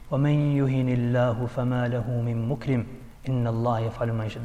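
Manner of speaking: low, soft Arabic recitation; Quran recitation. Retold or imitated. Shaykh recalls one ayah, quietly recited.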